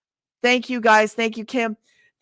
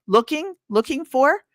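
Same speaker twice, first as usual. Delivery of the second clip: usual speaking voice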